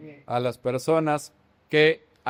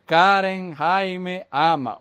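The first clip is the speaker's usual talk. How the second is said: slow word-by-word example; much slower than usual. Shown as a demonstration. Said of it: "O se puede escribir".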